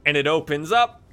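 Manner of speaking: ordinary talk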